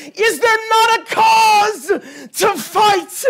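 breaking shouted call to fight; impassioned shout. Acted. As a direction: cry it out to the church. Preacher's passionate cry.

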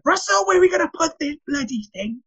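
high-pitched exasperated wife, retold; fuming wife. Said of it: "who was fuming".

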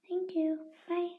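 usual speaking voice

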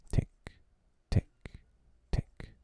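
soft, spaced ticking sounds; ticking clock. A narrator's reading. The approaching crocodile's ticking clock. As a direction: let each tick creep closer.

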